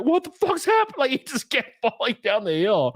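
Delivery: very high, squealing disbelief; high-pitched retold meltdown; hysterical mock panic. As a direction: freak out at an endless scene. Acting out stoned panic at movie.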